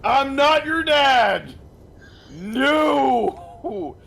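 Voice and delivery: loud, high, drawn-out protest; outraged denial. Acted. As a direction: wail it out in outraged refusal. Acted refusal of fatherhood.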